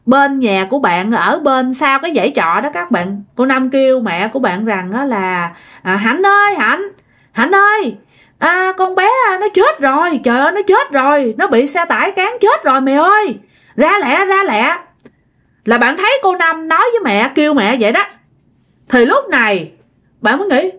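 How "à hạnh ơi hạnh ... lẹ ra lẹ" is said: high, frantic call across the yard; panicked calling out. Retold or imitated. Aunt calls to the mother across the yard, panicked high calling. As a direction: cry out for Hạnh in alarm, voice pitched high.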